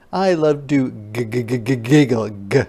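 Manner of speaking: ordinary talk, nothing marked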